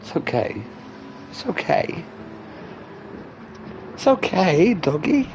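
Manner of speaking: high, soft reassurance for a nervous dog; calm the dog down gently; high cooing dog talk; soothing the dog. Real, not acted. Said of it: "soothing the dog".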